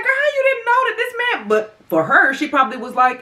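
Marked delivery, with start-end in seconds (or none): 0.0-1.5 high-pitched exclaimed disbelief; mock incredulous. Reacting to a woman who claimed she didn't know, incredulous.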